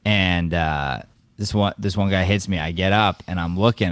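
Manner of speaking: ordinary talk, nothing marked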